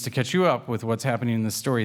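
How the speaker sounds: normal talking voice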